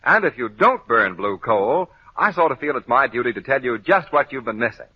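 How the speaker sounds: usual speaking voice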